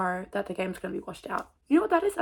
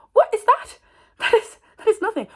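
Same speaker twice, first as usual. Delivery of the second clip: high, loud, disbelieving; a sudden high, loud "what is that"; incredulous outburst; 13 semitones higher, 11 dB louder than usual. Real, not acted. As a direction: scoff at your own excuse. Mock outrage at herself.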